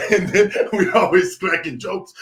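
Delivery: ordinary talk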